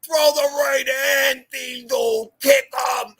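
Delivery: high slow shout from the back window. Retold or imitated. Godfather shouting from the back window at the fight, high and slow. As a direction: yell encouragement at a street fight. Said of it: "Him and my godfather are in the back window".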